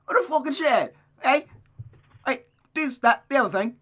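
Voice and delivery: high, loud mock-British rant; hammed-up British accent, loudly blurted; Gavin Free impression. Reader goofing as the character, mock-British accent. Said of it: "Make her sound like Gavin Free".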